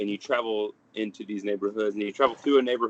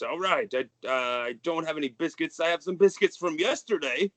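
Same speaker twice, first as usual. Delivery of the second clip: a touch higher, chatty host; jolly father; 6 semitones higher than usual. Acted. The merry father answering guests about biscuits, chatty and light. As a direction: fuss over the guests' tea. GM voices Hobart talking biscuits.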